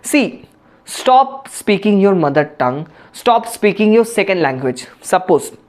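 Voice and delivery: normal talking voice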